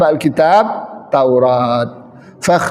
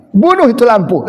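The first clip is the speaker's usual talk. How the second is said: raised Makassar-style "Bunuh itu lampu"; Makassar accent; 4 semitones higher than usual. Retold or imitated. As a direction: stress "Bunuh" falling, Makassar dialect. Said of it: "orang Ujung Pandang". (logat Makassar, "Bunuh" ditekan)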